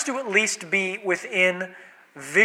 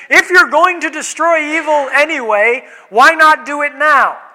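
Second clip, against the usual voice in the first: raised, louder indignant demand; defiant challenge; 7 semitones higher, 6 dB louder than usual. Acted. People shaking a fist at God, loud indignant challenge. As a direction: demand an answer, high and loud. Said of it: "when we shake our fist at God".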